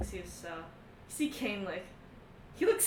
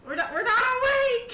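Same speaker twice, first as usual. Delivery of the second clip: hysterical; 10 semitones higher, 5 dB louder than usual. Acted. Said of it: "he says. He's so in hysterics".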